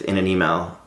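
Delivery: normal talking voice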